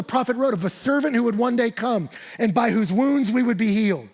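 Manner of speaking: impassioned preacher building to a peak; preaching at full force. Acted. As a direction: preach it hard and high.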